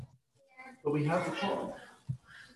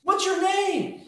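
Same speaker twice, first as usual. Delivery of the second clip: Moses pleading; 10 semitones higher than usual. Acted.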